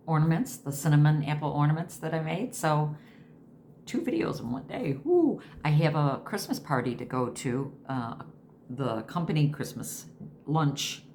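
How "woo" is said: high, loud little cheer of triumph; a quick self-cheer, pitched up; woo. Real, not acted. Cheering to viewers about two videos in one day, high excited woo.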